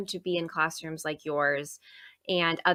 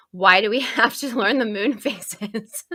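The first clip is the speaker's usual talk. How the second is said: laughing imitation; 4 dB louder than usual. Teacher quoting a curious student's silly question, laughing.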